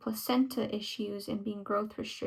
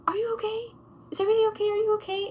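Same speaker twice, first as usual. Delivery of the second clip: imitating a worried nurse, pitched way up; high concerned nurse; 10 semitones higher, much faster than usual. Nurse checking on a crying patient, high and worried. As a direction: ask if she's okay, high and anxious. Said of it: "She's like".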